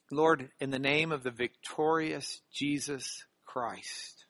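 closing prayer. Real, not acted. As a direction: pray a blessing over them, measured and hushed.

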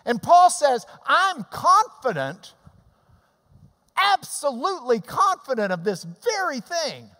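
high, loud preaching build; fervent preaching. Acted. Preacher urging congregation to pay attention, fervent. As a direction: drive the point home to the flock.

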